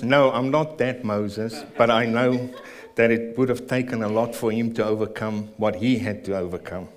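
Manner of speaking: normal talking voice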